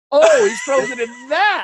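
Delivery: high, loud slowed realization through laughter; laughing realization. Retold or imitated. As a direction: laugh as the truth finally lands.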